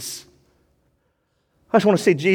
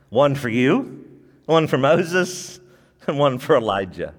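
voicing Peter; 7 semitones lower than usual. Peter voiced, slow and lower.

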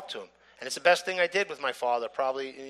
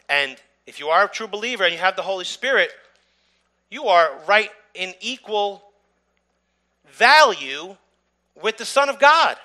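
louder and higher, driving each phrase home, some slowed; fervent preaching; 5 semitones higher, 8 dB louder than usual. Acted. Teaching the congregation about being co-heirs, fervent preaching. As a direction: preach the point with full conviction.

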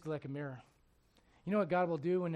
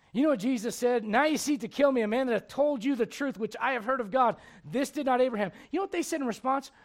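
raised, emphatic sermon delivery; energetic preaching; 7 semitones higher, 10 dB louder than usual. Acted. Preacher retelling Nathan's visit to David, energetic preaching. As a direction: press the point like a preacher.

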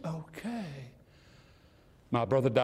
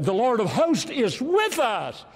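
preaching at full force; 8 semitones higher, 4 dB louder, slower than usual. Acted. Preacher quoting the verse.

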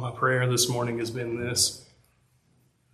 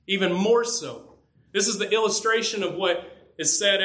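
impassioned preaching; 6 semitones higher than usual. Acted. Pastor urging the congregation that loving Christ is vital, fervent preaching. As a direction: press the point home like a revival preacher. Preacher drives home his point.

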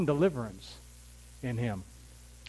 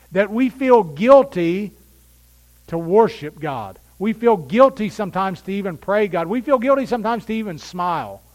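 higher, louder impassioned preaching; preaching at full force; 4 semitones higher, 6 dB louder than usual. Acted. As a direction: press the point on the congregation.

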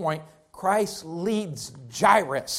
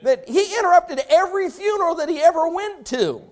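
full-force preaching; 9 semitones higher, 6 dB louder than usual. Acted. Preacher contrasting facts and truth, full force. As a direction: drive each phrase home to the church.

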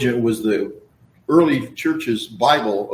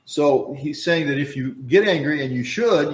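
usual speaking voice

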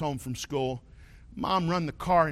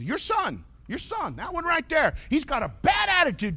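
high, mocking mom telling on her son; mom complaining; 13 semitones higher than usual. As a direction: point at the boy and complain way up high. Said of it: "He said".

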